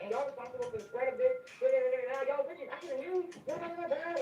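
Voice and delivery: patient ranting at the nurse, a retold mock tirade; fussing someone out. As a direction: retell a tirade at the nurse, half-hammed. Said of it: "I was fussing this woman out".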